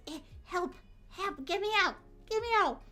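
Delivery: high-pitched little mock-panicked cries; playful cries for help. Streamer pretending to be stuck in snow, playful cries for help. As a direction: squeak for rescue, hammed up.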